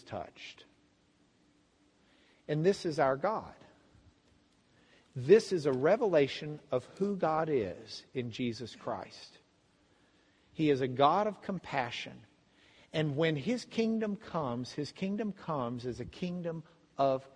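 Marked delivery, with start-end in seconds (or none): none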